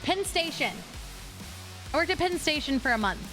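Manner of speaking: ordinary talk, nothing marked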